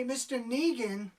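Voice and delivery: ordinary talk, nothing marked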